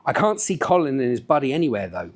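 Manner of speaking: usual speaking voice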